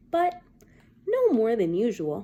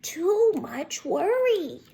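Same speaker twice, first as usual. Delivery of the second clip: higher, slow tut-tutting grandma; slow grandmother; 8 semitones higher, 3 dB louder, much slower than usual. Acted. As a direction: draw it out like a fussy grandma. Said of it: "said her grandmother".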